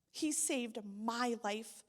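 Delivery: ordinary talk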